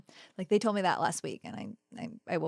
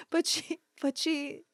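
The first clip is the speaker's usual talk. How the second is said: high laughing delight; 10 semitones higher, 3 dB louder than usual. Real, not acted. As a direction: laugh while praising, higher. Laughing praise.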